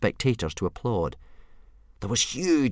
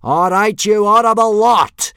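high, full-force sergeant bellow; bad-tempered yelled address; yelling; 14 dB louder than usual. A narrator's reading. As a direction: roar at a line of recruits. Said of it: "yelled Gobber".

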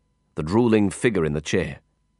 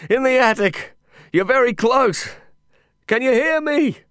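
shouting. Acted. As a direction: yell so he can find you. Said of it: "I shouted back".

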